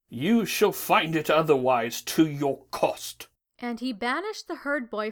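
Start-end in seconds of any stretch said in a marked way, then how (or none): 0.1-3.3 low, loud, slow godly threat; angry deity. A narrator's reading. Angry god warning his defiant daughter, deep, slow and loud. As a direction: pronounce a doom in a big deep voice. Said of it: "was angry".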